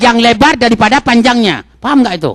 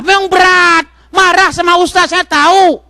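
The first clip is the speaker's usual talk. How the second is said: emphatic exclamation; 8 semitones higher than usual. Acted.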